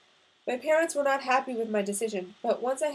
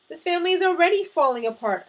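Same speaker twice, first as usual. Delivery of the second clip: loud, high angry retort; shouting back; 7 semitones higher, 9 dB louder than usual. A narrator's reading. Son answers his screaming father, shouted retort. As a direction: fire it back at full volume. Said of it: "I shouted back".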